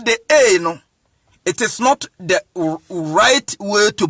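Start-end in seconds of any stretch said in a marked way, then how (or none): none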